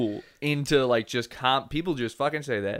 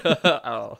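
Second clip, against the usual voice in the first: ordinary delivery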